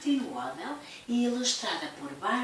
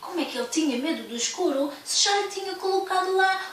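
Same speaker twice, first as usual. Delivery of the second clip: higher, louder, amazed; surprised; 7 semitones higher, 5 dB louder than usual. Acted. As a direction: sound truly astonished. Big bear amazed. (muito espantado)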